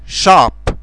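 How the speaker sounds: "shop" with a Romanian ș at the start; English sh. Shown as a demonstration. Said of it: "se pronunță ș". ("shop" începe cu ș)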